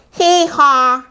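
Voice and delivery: high two-note hee-haw; donkey bray. A narrator's reading. Donkey waking the stable, high bray. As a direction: imitate a donkey's loud bray. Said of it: "brayed very loudly".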